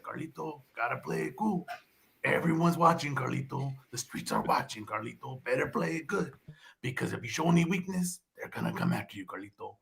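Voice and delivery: very soft, low inner-monologue voice; Carlito impression. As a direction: talk to yourself in your head, hushed and low. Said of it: "when Carlitos talking to himself in his head".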